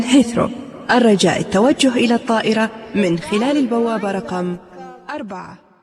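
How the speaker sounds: loud, fast PA boarding call; airport announcement. Acted. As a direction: announce over airport speakers.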